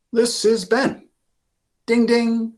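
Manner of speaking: high, lively Ben voice ringing his bell; a kid ringing his bell, way up high; young boy and bell, voiced high and bright; bright bike bell. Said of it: "goes his bike".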